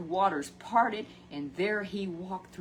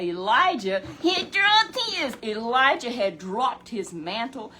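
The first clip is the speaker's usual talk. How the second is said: slow, loud emphatic preaching; emphatic proclamation; 4 semitones higher, 8 dB louder, slower than usual. Real, not acted. Preacher stresses the dropped mantle, slow loud emphasis. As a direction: lean hard on each word, slow and loud.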